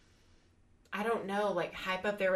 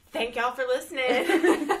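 loud, slow, laughing goodbye; laughing sign-off; 6 semitones higher, 12 dB louder, slower than usual. Real, not acted. Host closing the podcast episode, laughing loudly and slowly.

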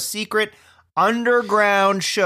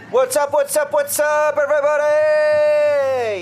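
stand-up hype intro; 6 semitones higher, much slower than usual. Pretend. Comedian hyping the crowd to open his set. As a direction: pump up the crowd like a stand-up host. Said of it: "All right".

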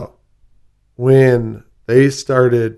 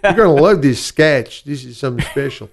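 put-on Chicago accent; 3 semitones higher, 4 dB louder than usual. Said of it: "talk like this with ... Chicago accents".

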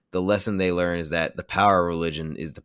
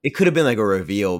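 louder, higher exasperated complaint; 4 semitones higher, 6 dB louder than usual. Real, not acted. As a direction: vent like the movie let you down. Frustrated rant about movie reveal.

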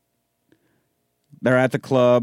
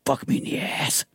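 whispered quote; 6 dB quieter than usual. Retold or imitated. Quoting crude movie line.

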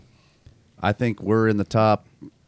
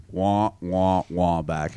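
softer, lower sad trombone wah; muffled wah wah talk; 5 semitones lower, 4 dB quieter, much slower than usual. Retold or imitated. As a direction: let it sink like a sad trombone. Sad trombone about trailer sales.

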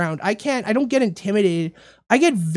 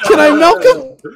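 mock leering homeless man; 7 semitones higher, 9 dB louder than usual. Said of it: "he goes".

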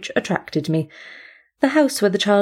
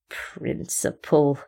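slow, low, soft sneer on Principle; 5 semitones lower, 5 dB quieter, much slower than usual. Acted. Speckle mocks the cat's principle with a slow sneer. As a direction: drag the word out in contempt. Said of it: "he sneered".

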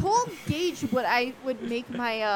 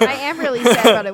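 loud mock sadness; 12 dB louder, faster than usual. Mock mourning over Anna.

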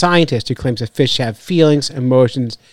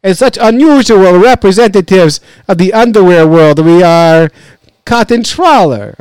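loud, higher, hammed-up Russian accent; 5 semitones higher, 7 dB louder than usual. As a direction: belt it out in a mock Russian accent. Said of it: "That's my best Russian accent".

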